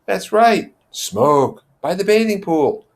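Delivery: put-on a touch of eager boys talking over each other; lightly voiced babbling boys. Boys eagerly agreeing about the fire. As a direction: chime in excitedly. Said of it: "the boys began to babble".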